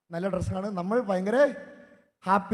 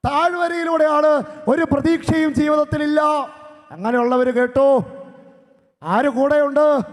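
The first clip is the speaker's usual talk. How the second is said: raised, emphatic sermon delivery; preaching; 7 semitones higher, 9 dB louder, slower than usual. Acted. Priest preaching to the congregation, raised and emphatic. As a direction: preach it out to the hall, slow on the key line.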